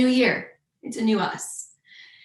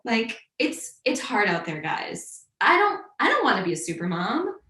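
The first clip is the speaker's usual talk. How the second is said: ordinary talk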